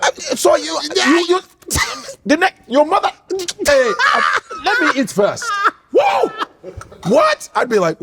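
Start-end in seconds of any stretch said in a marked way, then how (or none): 0.0-5.4 high-pitched, halting sputter; mock rage breaking the sentence into fragments; mad father imitated. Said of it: "he's like this". 5.9-7.5 very high startled yelp; mock alarm. Reacting to angry father's broken speech.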